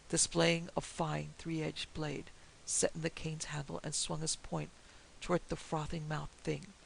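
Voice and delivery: ordinary delivery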